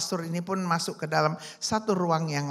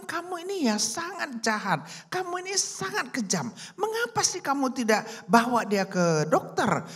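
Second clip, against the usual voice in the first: higher-pitched angry rebuke; angry scolding; 8 semitones higher than usual. A narrator's reading. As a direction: scold him as a shocked priest. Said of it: "pastornya marah sekali".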